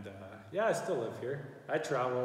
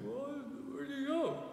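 whiny commenter voice; 3 dB quieter than usual. Retold or imitated. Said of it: "all these guys would comment and be like".

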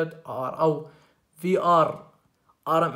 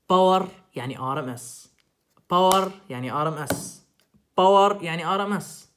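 باور hammered out loud, the whole phrase repeated louder each time; emphatic repetition; 2 semitones higher, 8 dB louder than usual. Shown as a demonstration. Teacher drilling the class on the power rule, loud and emphatic. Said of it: "كنت أحكي كلمة".

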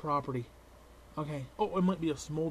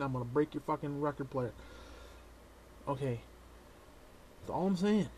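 ordinary talk, nothing marked

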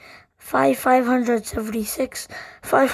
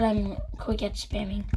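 whisper; 3 semitones lower, 6 dB quieter than usual. Real, not acted. Amazed aside to self.

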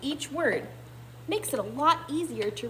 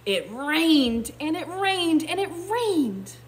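"rained" stressed each time, climbing higher; building repetition; 6 semitones higher, 5 dB louder, slower than usual. A narrator's reading. Teacher narrating endless rain, building repetition. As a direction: lean on every "rained", drawing out the last. Story's rain keeps falling.

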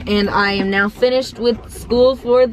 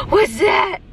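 startled; 7 semitones higher than usual. Real, not acted. Startled by car wash noise.

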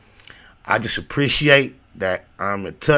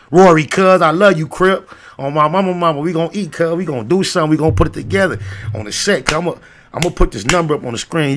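higher, fired-up promise to a friend; excited shout-out; 7 semitones higher, 4 dB louder than usual. Real, not acted. Promising Rory they will build together, real excitement. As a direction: open loud, then keep it high and eager.

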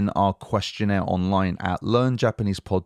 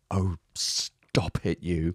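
mock coy flattered; 2 semitones lower, 5 dB quieter, much slower than usual. Flattered by praise of himself.

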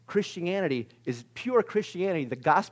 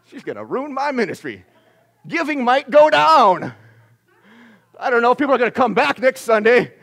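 mock alarm; 9 semitones higher, 14 dB louder than usual. Preacher mocking a pastor's worry, loud mock alarm. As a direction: shout out the fake worry.

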